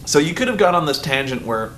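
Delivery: ordinary talk, nothing marked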